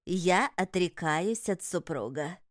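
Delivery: slow, solemn vow with stress on я; solemn renunciation. A narrator's reading. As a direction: declare it like a sacred oath. Ritual renunciation.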